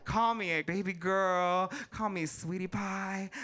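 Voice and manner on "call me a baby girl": ordinary talk, nothing marked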